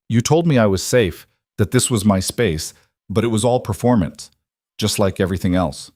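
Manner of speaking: ordinary delivery, nothing marked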